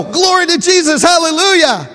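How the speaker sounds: loud, high praising shout; joyful praise. Retold or imitated. Preacher showing the praise they should have given, loud. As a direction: cheer like a revival crowd. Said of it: "They didn't go".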